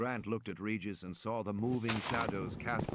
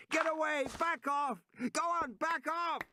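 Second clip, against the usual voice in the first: shouting. A narrator's reading. Man yelling to drive off a young dinosaur, shouting. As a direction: shout it at the top of your lungs. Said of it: "Regis was shouting at the top of his lungs".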